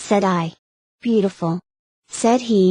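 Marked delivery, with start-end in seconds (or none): none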